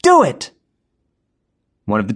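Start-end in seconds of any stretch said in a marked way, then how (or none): 0.0-0.5 high, loud threat rising to a shriek; threatening yell. A narrator's reading. Jenny threatening bank tellers, shrill loud shout. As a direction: scare them off the alarm. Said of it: "Jenny yelled".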